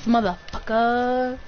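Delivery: ordinary talk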